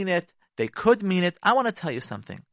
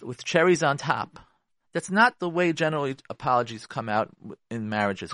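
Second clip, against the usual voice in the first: ordinary talk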